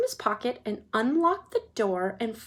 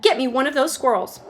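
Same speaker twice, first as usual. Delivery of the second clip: put-on bratty demanding girl; 3 semitones higher, 8 dB louder than usual.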